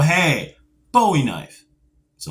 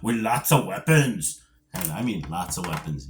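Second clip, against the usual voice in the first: a half-hearted stab at an action-hero growl; bad Schwarzenegger impression; 6 semitones lower than usual. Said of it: "that was a horrible Schwarzenegger impersonation".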